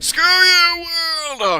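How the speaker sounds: high, loud drawn-out yell; mock outburst. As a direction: yell it at the whole world. Mock outburst after brother is shut out.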